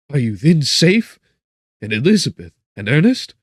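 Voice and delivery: loud, high urgent questions; anxious cry. A narrator's reading. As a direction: cry out to your father for news. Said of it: "and cried".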